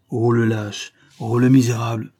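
ordinary delivery, nothing marked

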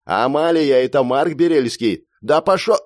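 loud, hurried call to be heard. A narrator's reading. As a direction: talk over her before she hangs up. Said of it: "мне пришлось самому повысить тон".

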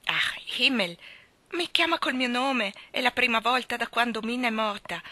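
esclamato forte e commosso, più alto; esclamazione commossa. A narrator's reading. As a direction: grida la sua emozione, rapito. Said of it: "egli esclamò". (esclamazione forte e commossa)

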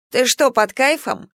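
amused scoff. A narrator's reading. Prisoner teasing his cursing companion, scoffing. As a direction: needle them with a light scoff. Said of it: "хмыкнул Рамса".

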